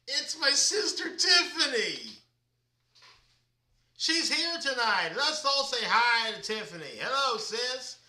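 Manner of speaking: light up and call out to your sister; excited greeting. Real, not acted. Host announcing his sister to viewers, excited and loud.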